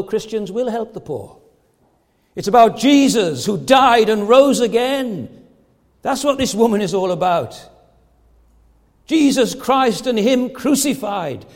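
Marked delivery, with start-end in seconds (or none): 2.4-11.5 loud, slow gospel proclamation; forceful preaching. Real, not acted. Preacher declares what the woman is all about, forceful preaching. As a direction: ring it out from the pulpit.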